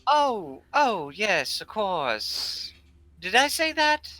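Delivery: high, loud mock surprise; feigned innocence. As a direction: play dumb, voice jumping high. Crow feigns forgetting, squeaky.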